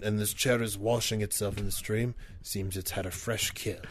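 murmured nature show narration; nature documentary. Pretend. Continues wildlife narration.

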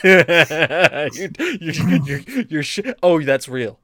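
stammering through laughter; laughing disbelief. Real, not acted. Recalling the huge asking price, laughing in disbelief. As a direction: laugh while trying to get the words out.

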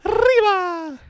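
huge high Spanish "Arriba" with trilled r. Pretend. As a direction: belt out a mock-Mexican Spanish cry.

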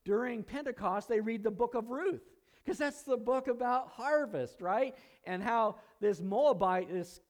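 high, loud, fired-up preaching; excited preaching. Acted. Pastor stirring the congregation about Pentecost, excited and high. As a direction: preach it with mounting wonder.

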